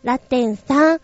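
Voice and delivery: ordinary talk, nothing marked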